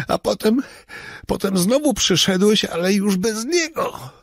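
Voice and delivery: breathless, high-pitched confession; frightened man recounting. Acted. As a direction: tell it in a rising panic. Robert insists.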